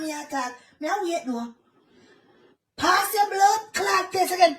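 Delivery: ordinary talk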